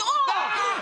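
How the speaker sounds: sudden high yelp at the hot tap; surprised yelp. Pretend. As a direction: yelp as if scalded.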